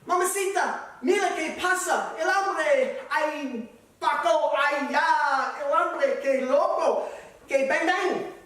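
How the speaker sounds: high, loud mock-Spanish alarm; excited Spanish son. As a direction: cry out the news in comic Spanish bursts. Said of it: "runs downstairs to tell Ramona and Rosita".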